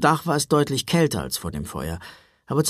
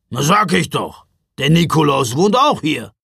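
louder gruff matter-of-fact voice; gruff Ruprecht; 2 semitones higher, 7 dB louder than usual. A narrator's reading. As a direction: grunt it out as obvious. (brummiger Ruprecht, laut und bestimmt)

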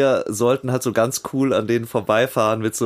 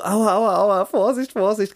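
crying out in pain; 8 semitones higher than usual. Retold or imitated. The doctor rolls up his jeans, pitched-up pained ows. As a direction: wince through the ows as the trouser leg goes up. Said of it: "Ich so".

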